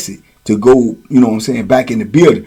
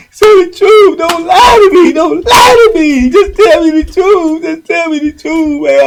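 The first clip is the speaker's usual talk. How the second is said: high mock-crying shout; imitated desperate demand; 13 semitones higher, 4 dB louder than usual. Mimicking the man begging Boo Boo for the truth, high wailing shout. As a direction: wail the demand at the top of your range.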